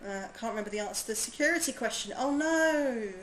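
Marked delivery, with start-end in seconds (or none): 2.2-3.1 dismayed oh no. Real, not acted. Forgot her security answer.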